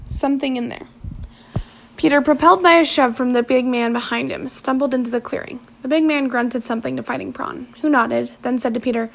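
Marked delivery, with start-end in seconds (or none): none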